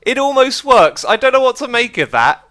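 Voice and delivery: cracking up at the joke build; real amused delight; amused disbelief. Real, not acted. Amused by shortened Beef.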